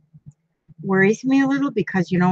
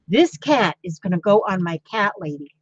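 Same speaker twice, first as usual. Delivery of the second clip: ordinary talk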